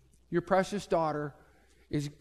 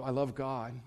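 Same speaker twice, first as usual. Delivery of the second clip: quiet reply; 5 semitones lower, 8 dB quieter than usual. Retold or imitated. John's calm reply.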